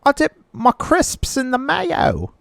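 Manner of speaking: camp it up as a cartoon Londoner; mock British accent. Hosts mocking European listeners, in a hammy high British accent.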